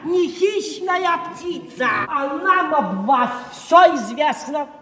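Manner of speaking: mocking shout. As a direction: shout the verse to humiliate him. Clerks ridicule Makar with verse.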